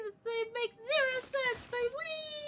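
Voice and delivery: high, bright-toned excited cheer. Real, not acted. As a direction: shout it giddily. Laughing while confused, cheering.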